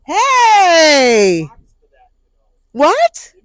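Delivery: loud shout across the park; calling out. Real, not acted. Calling out to passersby.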